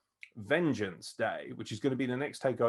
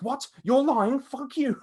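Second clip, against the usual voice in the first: high, loud indignant outburst; pissed off; 13 semitones higher, 7 dB louder, slower than usual. Retold or imitated. Wrestler reacting to bad news backstage, pissed off. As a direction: imitate a wrestler's outraged disbelief. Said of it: "how fucking pissed off he was".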